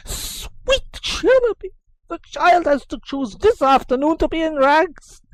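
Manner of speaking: high, slow fretful exclaim; flustered nurse lamenting the boy's rags; fretting nurse. Acted. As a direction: cry out to the heavens like a flustered nurse.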